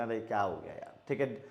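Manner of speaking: normal talking voice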